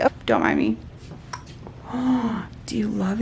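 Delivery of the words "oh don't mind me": usual speaking voice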